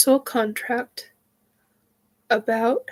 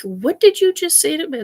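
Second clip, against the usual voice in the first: much higher and louder, an indignant outburst; shocked outrage; 8 semitones higher, 7 dB louder than usual. Retold or imitated. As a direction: demand an answer in disbelief. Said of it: "I about lost my shit over that one".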